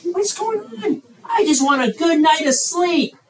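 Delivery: hammed-up sleepless complaint, rising to an outburst; mock exasperation. Preacher imagines being woken repeatedly, mock exasperated. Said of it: "I would have been like".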